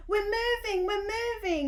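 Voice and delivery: put-on thrilled boy shouting; happy shout. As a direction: cheer it out in delight. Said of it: "he shouted happily".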